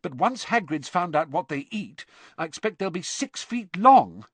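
pointing out the obvious, fed up; exasperated. Acted. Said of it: "in an exasperated voice".

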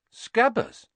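high-pitched stunned question; dumbfounded one-word query; blank surprise. A narrator's reading. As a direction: ask it in blank disbelief. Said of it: "said Ron blankly".

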